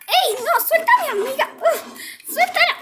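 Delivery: high, loud pleading yells; frantic yelling. Acted. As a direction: yell to free your friend. Defiende a su amiga. (gritos desesperados y agudos)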